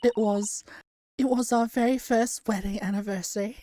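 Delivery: a wife's put-on Essex accent, mouth wide open. Streamer voicing the wife in a game, in a put-on Essex accent. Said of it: "trying to do an Essex accent for this woman".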